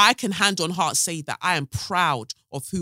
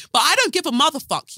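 higher, louder defiant cry; defiant outburst; 11 semitones higher, 7 dB louder than usual. Real, not acted. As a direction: belt out the defiance.

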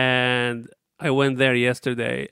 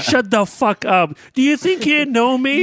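louder, higher hammed-up snap at the barista; half-laughing fake fury, climbing higher; mock aggressive outburst; 11 semitones higher, 5 dB louder than usual. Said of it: "I said it way too aggressively".

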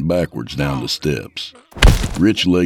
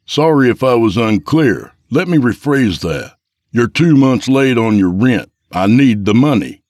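louder, firm and stern; raised voice; 7 semitones higher, 6 dB louder than usual. A narrator's reading. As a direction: raise your voice, spell it out. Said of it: "Rich raised his voice".

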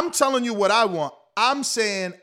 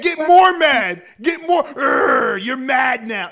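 shouted, drawn-out "get more mad"; mock coach yell; 4 dB louder, slower than usual. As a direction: bark it like a hype coach. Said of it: "He said".

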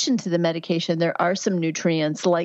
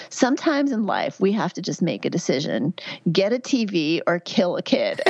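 normal talking voice

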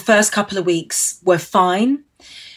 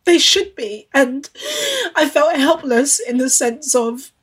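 close to tears; 5 semitones higher than usual. Real, not acted. Telling interviewer of press hurt, near tears. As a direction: hold back tears through the words. Still tearful, listener consoles.

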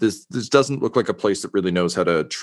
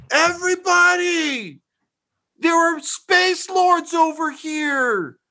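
a very high, slow shouted call; peasant yelling; slower than usual. Acted. Peasant calling everyone over to the Space Lords, shrill shout. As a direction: hoot it out to the whole street. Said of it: "he just yells".

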